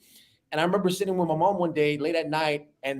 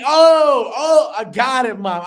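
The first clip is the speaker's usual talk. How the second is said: loud, high, drawn-out penny-drop; dawning realisation; 6 semitones higher, 10 dB louder, much slower than usual. Retold or imitated. Son finally understanding his mom's meaning, exaggerated realisation. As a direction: act out suddenly getting it, big and slow.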